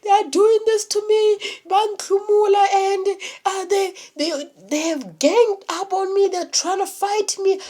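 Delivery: imitating the drunk, beaten guy, high and aggrieved; high whiny mock voice. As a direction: whine it like the guy who thinks everyone ganged up on him. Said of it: "I'm, like, asking".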